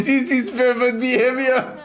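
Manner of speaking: laughing mock French accent; a laughing attempt at a French accent; imitating French accent. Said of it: "French TikTok".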